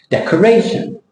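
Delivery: decoration with RAY high and -tion reduced; decoration, one high peak on RAY; correct English stress. Shown as a demonstration. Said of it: "Different shape altogether".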